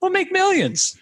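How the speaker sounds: very high-pitched, silly promise; mock hype seller. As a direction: squeal the get-rich promise in parody. Parodying get-rich-quick seller.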